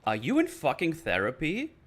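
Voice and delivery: mild teasing jab; retold taunt. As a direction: poke fun at him, half-hearted. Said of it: "And then Dina all of a sudden says".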